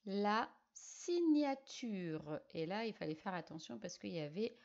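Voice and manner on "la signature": slow dictation. Shown as a demonstration. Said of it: "quatre syllabes à écrire".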